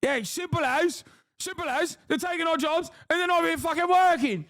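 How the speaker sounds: high, fast, shouted parody; mock EDL lad. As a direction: parrot him, high and shouting. Parroting the interviewee.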